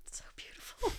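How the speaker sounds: whispered softly in quiet wonder. Real, not acted. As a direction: breathe it like a sigh of awe. Moved by guest's reflection.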